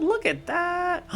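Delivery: drawn-out, high mock-admiring; slow falsetto. Streamer admiring the garage in slow falsetto. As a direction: savour it slowly up high.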